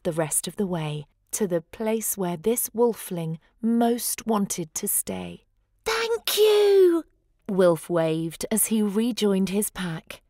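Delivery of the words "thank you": high, loud glad call; joyful thanks. A narrator's reading. Rejoined pup thanking his helpers, joyful shout.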